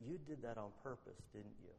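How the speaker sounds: quietly, a secret said close to her ear; boy quietly confides to Susie at the bus, hushed; soft hushed voice. Acted. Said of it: "he whispers in his, in her ear".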